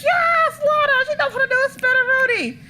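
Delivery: high, fast gushing cheer; excited cheer. Retold or imitated. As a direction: cheer her on like a thrilled fan. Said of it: "and I was just like".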